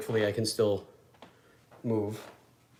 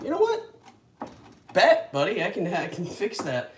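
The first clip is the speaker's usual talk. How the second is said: eager reply; 7 semitones higher, 4 dB louder than usual. Retold or imitated. Answering a fan's request.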